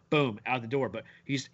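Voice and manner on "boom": ordinary delivery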